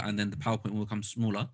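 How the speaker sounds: normal talking voice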